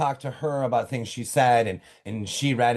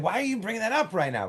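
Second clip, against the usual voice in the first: high, fast, mildly upset quote; imitating a friend's irritated pushback; upset retort; 8 semitones higher, faster than usual. Friend snaps at the speaker for raising it, mildly upset. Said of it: "Kenickie was upset".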